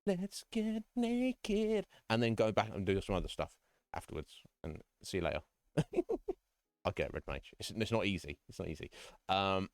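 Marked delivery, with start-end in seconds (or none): 0.1-1.9 slow, drawn-out playful mock voice, rising high at the end; lightly voiced friend turning flirty; voicing Red Mage. Streamer mocks Red Mage's love language, slow and teasing. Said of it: "It's like". 5.8-6.3 high giggle. Real, not acted. Laughs at own joke.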